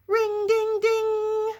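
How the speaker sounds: high, loud ding; ringing bell. Acted. Reader voicing the cat's bell, high loud rings. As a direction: ring it out like a bell. Reading bell noises aloud.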